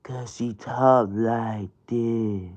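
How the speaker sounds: low, slow and soft, a put-on woman's voice; imitating Brittany's voice. Comedian mimics Brittany's odd voice for the crowd, low and slow. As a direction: drop it low and slow into her voice. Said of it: "I was always good at talking like her".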